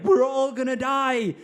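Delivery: hammed-up disaster-movie scream; mock scream. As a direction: scream it like the panicked guy in a film. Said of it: "there's a guy screaming".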